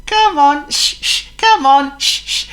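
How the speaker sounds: high, loud puffing engine voice; cheery little engine. Acted. Thomas urging the trucks on, high and puffing. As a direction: chug along cheerfully. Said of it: "he replied".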